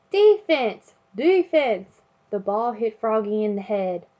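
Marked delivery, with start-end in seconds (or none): 0.1-2.0 high, loud coach's yell. Acted. Dad yelling from the sideline, loud yell. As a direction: shout it across the field. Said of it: "Froggy's dad was yelling".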